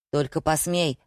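usual speaking voice

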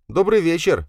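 put-on high-pitched young man's greeting; nervous son on phone. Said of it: "прерывисто зажимая телефон двумя руками". (высокий голос взволнованного сына)

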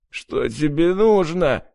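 high, slow howled question; howling. A narrator's reading. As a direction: wail it in agony. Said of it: "выл сатанист". (воет сатанист)

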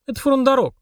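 put-on a touch of gloom in a high girl's voice; glum, high-pitched answer. A narrator's reading. Polina answering Mashka about the demon, glum. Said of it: "угрюмо ответила Полина".

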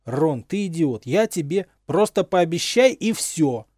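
loud, slow furious yell at his friend; shouting at full voice. A narrator's reading. Harry yelling at Ron to just promise, loud shout. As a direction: bellow it out in exasperation. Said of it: "крикнул Рыжий во весь голос".

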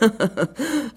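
high, soft-edged refined laughter; mocking laugh. A narrator's reading. Peacock mocks the goose's dignity, refined laugh. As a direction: let out a superior ripple of laughter.

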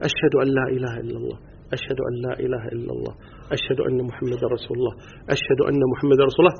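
hushed, low recitation of the shahada. Shown as a demonstration. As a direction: say it softly, as if only you can hear. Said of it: "ثم يقول بصوت منخفض يسمع نفسه فقط". (بصوت منخفض هامس يسمع نفسه فقط)

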